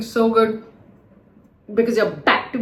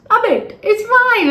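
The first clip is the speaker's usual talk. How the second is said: teasing; 11 semitones higher, 5 dB louder than usual. Real, not acted. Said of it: "am I laughing at your expense?".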